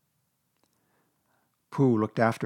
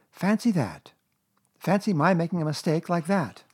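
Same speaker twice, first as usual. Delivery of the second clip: raised marvelling mother voice; Kanga's mock surprise; 7 semitones higher than usual. A narrator's reading. Kanga pretending she made a mistake, mock surprise. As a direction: marvel innocently, pitched up.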